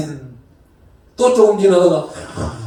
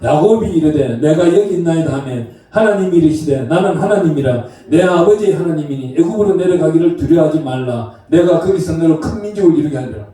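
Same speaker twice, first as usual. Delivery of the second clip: solemn, measured Bible reading, a touch lower; scripture reading; 7 semitones lower than usual. Acted. Pastor reads Genesis to the congregation, solemn reading voice.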